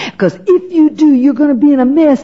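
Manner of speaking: Satan warning her against giving her life to Christ, tempting voice; Satan's warning. Retold or imitated. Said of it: "It was Satan saying".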